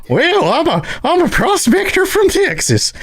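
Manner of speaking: very high, slow, hammed-up Texan; cartoon old-timer prospector with a Texas twang; Texas prospector accent. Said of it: "you're in Texas and you're like".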